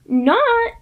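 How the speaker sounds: sharp high mock yell of Not; a jeering high shout; imagined yell. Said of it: "to yell".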